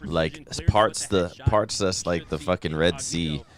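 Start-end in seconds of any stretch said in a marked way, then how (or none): none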